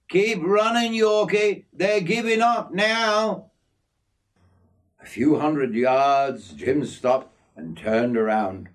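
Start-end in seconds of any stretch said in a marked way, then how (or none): 0.1-3.5 higher-pitched call of encouragement; urging on. A narrator's reading. Jim tells Yorkie the bees are giving up, urging on. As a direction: urge a mate onward. Mate urges him on.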